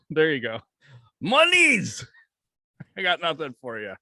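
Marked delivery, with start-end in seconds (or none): 1.2-2.1 high, loud mock Russian accent on "Monies"; hammed-up Russian greed in a Russian accent. Still joking as the Russian handler in a mock Russian accent. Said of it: "my best Russian accent".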